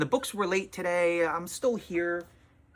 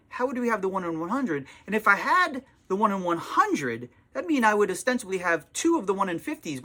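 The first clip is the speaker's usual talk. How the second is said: lecturing, talking down, a touch higher; retold condescending explanation; 6 semitones higher than usual. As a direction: spell out the math impatiently. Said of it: "your attitude about that".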